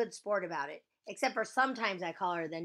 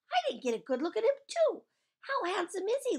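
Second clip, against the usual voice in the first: eager questioning; 14 semitones higher, 7 dB louder than usual. Acted. Eager questions about handsome boy.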